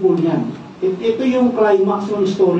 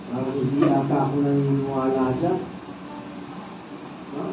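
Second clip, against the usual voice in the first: doubting unbeliever; 7 semitones lower, 9 dB quieter than usual. Acted.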